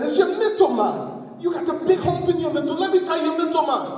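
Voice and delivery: high-pitched trickster persuasion; urgent coaxing. Acted. Anansi goads snake with feigned concern.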